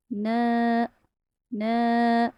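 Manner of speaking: न held for two counts; lightly stretched na. Shown as a demonstration. Teacher shows the two-count madd on न. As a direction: stretch न gently for two beats. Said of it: "इसको हल्का सा खींचेंगे".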